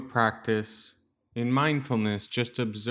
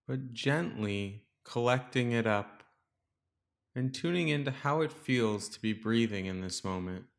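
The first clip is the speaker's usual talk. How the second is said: calm, softer meditation voice with long pauses; guided meditation; 5 dB quieter than usual. Real, not acted. Teacher guiding listeners to settle into the breath, calm meditation voice. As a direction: guide listeners gently into stillness, leaving space between phrases.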